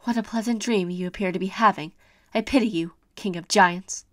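normal talking voice

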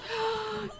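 quick, soft high gasp; frightened gasp. Real, not acted. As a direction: catch your breath in fear. Frightened by possessed Sim.

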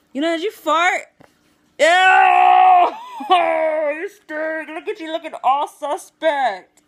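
loud, high-pitched teasing accusation; playful disgust. Real, not acted. Mock disgust at companion's fart.

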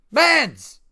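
loud high call across the house; calling a dog who won't come; shouting for the dog. Real, not acted. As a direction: yell the dog's name. Dog not coming when called.

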